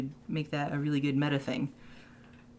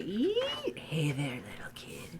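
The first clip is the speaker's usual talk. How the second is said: creepy whisper. Pretend. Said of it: "plays a creepy sound".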